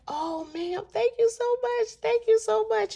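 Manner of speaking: very high-pitched gushing thanks; acted-out thankfulness, voice lifted way up; overwhelmed gratitude. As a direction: imitate an overwhelmed, grateful woman. Homeless woman thanks her for gifts.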